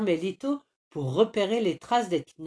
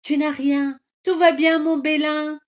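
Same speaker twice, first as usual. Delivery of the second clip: joyful reunion cries; 9 semitones higher, 8 dB louder than usual. Acted. Friends reunite after the rescue, overjoyed cries. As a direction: cry out in relief.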